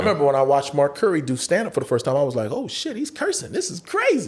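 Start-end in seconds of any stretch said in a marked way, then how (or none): 2.5-4.3 high-pitched retold astonishment; amazed. Watching a comic curse first time, high amazement. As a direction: react in a raised, marvelling voice.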